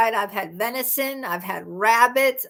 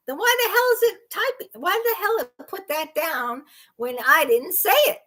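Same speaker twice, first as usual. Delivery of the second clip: raised-pitch, indignant questioning; exasperated; 6 semitones higher than usual. Real, not acted. Streamer complaining to viewers about autocorrect, exasperated. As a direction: demand an answer, fed up with autocorrect.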